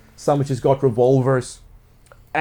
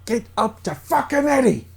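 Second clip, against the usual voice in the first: high, strained angry command; agitated order; 10 semitones higher than usual. Acted. Max waving the gun at you, high agitated order. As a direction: order them on in a frantic high voice.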